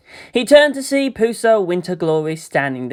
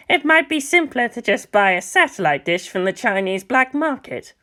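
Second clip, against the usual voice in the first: a lightly voiced old teacher, musing; old lama voice; 7 semitones higher than usual. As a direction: play the wry elder lama, curious. Old lama proposes satellite dish.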